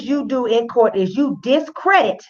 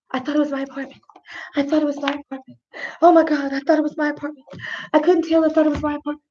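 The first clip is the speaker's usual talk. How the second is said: higher, softer mock repeated plea; lightly voiced, higher-pitched replay of an excuse; imitating Amber Geiger; 4 semitones higher, 3 dB quieter, faster than usual. Host mimics the officer's repeated excuse, higher mock plea. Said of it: "Amber Geiger is saying the whole time".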